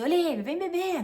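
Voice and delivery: imitating cousin. Cousin calling Olivia to come drink, high and teasing. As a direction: call her over to drink. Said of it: "o meu primo falou assim".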